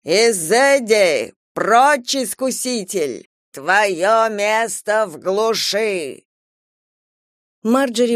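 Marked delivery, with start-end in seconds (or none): 0.0-6.2 very slow, drawn-out banishing cry; commanding exorcist shout. Acted. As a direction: cast the demon out, word by word. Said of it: "Отец Уондерли крикнул".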